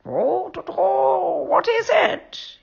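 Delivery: high, slow, drawn-out owl voice; sleepy old owl. A narrator's reading. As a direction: croak it out as a roused owl. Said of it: "he asked in a hoarse voice".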